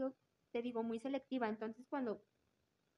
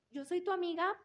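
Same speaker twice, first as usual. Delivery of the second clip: higher, louder sugary imitation of a two-faced friend; mock fake friend; 6 semitones higher, 6 dB louder than usual. Mocking a classmate who pretends to be her friend, higher and louder. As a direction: play the fake friend sweetly.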